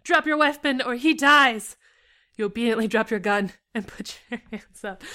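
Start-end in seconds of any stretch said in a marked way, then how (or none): 0.0-1.8 slow, high, loud threat; yelled threat. A narrator's reading. Robber threatening the hostage's life, yelling. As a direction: yell it slowly, each word a threat. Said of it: "the man holding Niall yelled".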